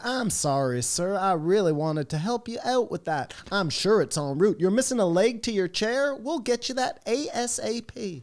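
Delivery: high, super-happy Midwest phone-agent voice; adorable Midwest accent. Retold or imitated. Wayfair phone agent apologizing to a customer, adorable Midwest accent. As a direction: mimic a bubbly Midwest agent, pitch climbing as you go. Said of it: "they have, like, this adorable accent".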